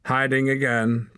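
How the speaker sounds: high mocking taunt. A narrator's reading. Hord taunts Torak for hiding behind the fire, high mocking. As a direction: sneer it at him. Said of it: "taunted Hord".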